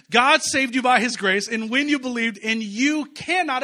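loud, slow preaching of scripture; booming pulpit read. Acted. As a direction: proclaim each word to the room. Preacher reads scripture loudly.

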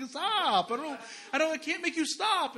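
high, pleading stop; desperate plea. Retold or imitated. Exhausted new dad pleads with screaming baby, high and desperate.

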